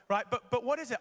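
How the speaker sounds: ordinary delivery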